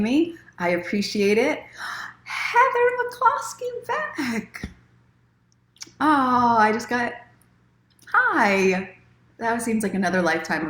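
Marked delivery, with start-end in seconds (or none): 1.7-2.2 quick unvoiced gasp of surprise; soft surprised intake of breath; sharp gasp. Real, not acted. Sudden reaction to a viewer. 2.3-9.0 much higher, slow, drawn-out delighted greeting; delighted surprise. Real, not acted. Greeting a returning viewer.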